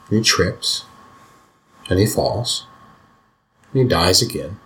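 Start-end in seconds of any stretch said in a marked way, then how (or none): none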